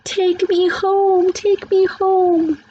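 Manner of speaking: put-on a terrified little boy screaming, drawn out; screamed plea. A narrator's reading. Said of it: "Sam grabbed onto his mother's leg and screamed".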